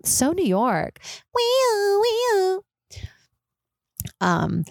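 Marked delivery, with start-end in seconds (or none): 1.3-2.6 high wailing wee-oo like an ambulance; siren imitation. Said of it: "hear the ambulances in the background".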